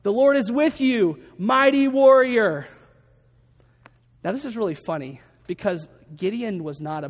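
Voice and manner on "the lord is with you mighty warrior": angel's proclamation. Retold or imitated. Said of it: "When the angel of the Lord appeared to Gideon, he said".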